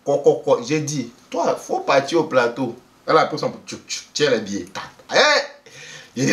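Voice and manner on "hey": high, loud hey; sudden surprised hey, higher and louder; surprised exclamation. Retold or imitated. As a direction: gasp it out in surprise.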